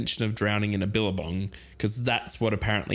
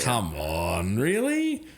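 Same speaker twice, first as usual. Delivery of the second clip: incredulous; much slower than usual. Acted. Incredulous pushback in debate.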